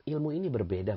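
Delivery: usual speaking voice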